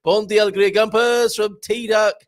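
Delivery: loud, high, bouncy show opener; big energetic greeting. Acted. Host restarting the show with full energy, loud. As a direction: burst in with energy. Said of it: "I need to be like that to start the show".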